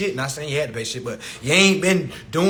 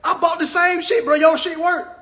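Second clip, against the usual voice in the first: mock excited dude; 10 semitones higher, 3 dB louder than usual. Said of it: "Ain't no dude walking into his homeboy crib".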